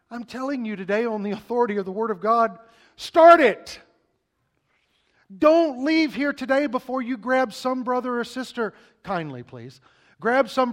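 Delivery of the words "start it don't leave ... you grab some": high, full-volume shout; shouted command. Real, not acted. Pastor rallying the congregation to start, shouting. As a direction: blast it out to the back row.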